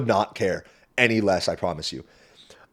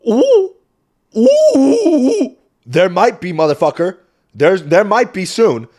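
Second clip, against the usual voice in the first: high, loud, laughing hype; hyped by the lady's challenge to her dad; hyped mock threat; 11 semitones higher, 11 dB louder, much slower than usual. Real, not acted. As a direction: egg on the fight with a laugh.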